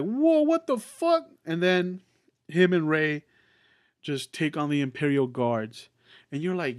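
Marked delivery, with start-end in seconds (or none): 0.0-1.3 very high, stretched-out whoa of shock; stunned disbelief. Retold or imitated. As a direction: act out a moviegoer floored by a twist. Acting out viewer's shock at twist.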